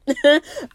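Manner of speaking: quick high laugh; high loud chuckle; short loud laugh. Real, not acted. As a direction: bark out a laugh. Laughing at own boast.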